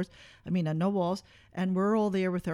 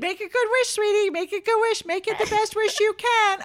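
high, sugary coaxing voice; sweet mom voice; 10 semitones higher than usual. Retold or imitated. Mom voicing herself at the party, high and sugary. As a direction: coax the birthday girl sweetly.

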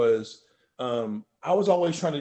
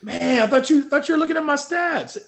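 mock outrage; 6 dB louder than usual. Real, not acted. Teasing mock outrage at host.

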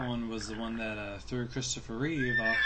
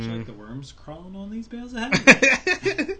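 loud playful question; 9 semitones higher, 10 dB louder, slower than usual. Pretend. Joking about worms in hay.

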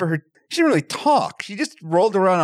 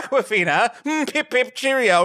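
higher, twee mock-genteel voice; guessed celebrity voice; 6 semitones higher than usual. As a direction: chirp it like a jolly old-fashioned toff. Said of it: "I'm guessing she's like".

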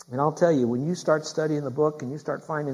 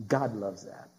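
low, soft and reverent; softly lowered after the climax; hushed; 5 semitones lower, 8 dB quieter, slower than usual. Real, not acted. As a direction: drop the voice low and quiet. Hushed repeat after loud climax.

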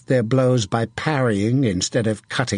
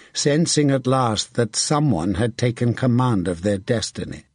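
ordinary delivery, nothing marked

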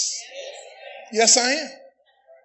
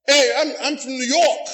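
put-on New York accent. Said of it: "the people from New York talk".

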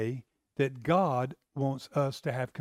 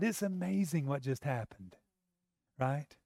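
normal talking voice

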